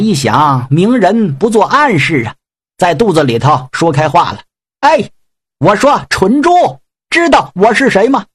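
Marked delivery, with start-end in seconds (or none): 4.8-8.2 higher, taunting voice calling down to the pig; mocking god warrior. Yang Jian taunts the pig demon from inside, higher mocking voice. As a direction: play the cocky god talking from inside the belly.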